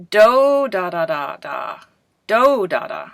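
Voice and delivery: each "Do" loud and long, the "da" syllables stepping down; intonation in nonsense syllables. Shown as a demonstration. Teacher has class guess the phrase, humming out its stress in "Do, da". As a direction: punch "Do" loudly, then let each "da" fall away. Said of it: "for the stress and the intonation".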